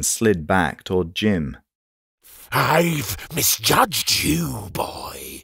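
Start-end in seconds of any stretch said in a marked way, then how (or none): 2.5-5.4 put-on slow, high, then a threatening whisper on boy; dripping with threat. Mage menaces Jim, slow and threatening. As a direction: purr the menace at him. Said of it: "his voice dripping with threat".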